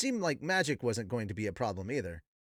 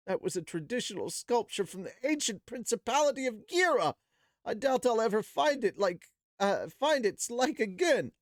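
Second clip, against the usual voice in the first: higher, lamenting craftsman voice; mournful Zenoba; 8 semitones higher than usual. Acted. Zenoba lamenting his ancient sculpture, high mournful voice.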